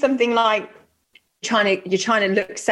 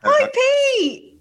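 excited; 14 semitones higher than usual. Real, not acted. Sing-song greeting to co-host.

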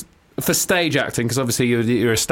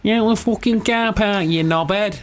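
high, drawn-out mock Mancunian; Manchester accent; 8 semitones higher, slower than usual. Host teasing the slipped accent, broad high Manchester. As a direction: stretch it out in exaggerated Manchester. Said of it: "That sounded like Manchester at the end".

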